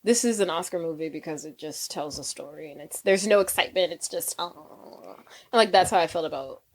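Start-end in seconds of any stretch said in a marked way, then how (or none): 0.0-5.1 low, deflated and unimpressed; bored reaction. Retold or imitated. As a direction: let it sag quietly into a bored sigh. Acting out bored reaction to films.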